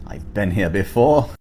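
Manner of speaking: put-on English accent. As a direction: imitate a toddler with an English accent. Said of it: "I said in an English accent".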